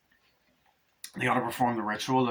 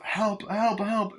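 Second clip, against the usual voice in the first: high acted cries for help; mock-panicked calling; desperate pleading; 10 semitones higher, much slower than usual. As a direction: call out like a fleeing victim. Acts out fleeing woman begging.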